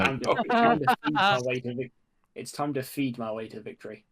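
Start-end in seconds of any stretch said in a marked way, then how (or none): 0.0-1.9 high, loud mock campaign slogan; mock proclamation. Player pitching for Hobbit votes, mock proclamation. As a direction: call it out high over the laughter.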